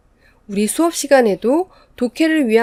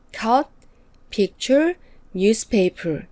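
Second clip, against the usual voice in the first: "cut", "picture", "newspaper" each given a clear beat; stressed words; much slower than usual. Shown as a demonstration. Teacher marking the stressed word of each chunk. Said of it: "마지막 단어에 강세를 넣어서 발음하면".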